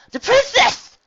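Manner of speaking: high, loud awed gasp of a small filly; a filly spotting the princess, astonished; surprised gasp. A narrator's reading. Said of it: "she gasped with, through a lisp".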